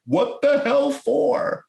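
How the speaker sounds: a husband protesting from bed, drawn out; imitated indignant old husband; grumpy husband. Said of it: "you hear this voice say".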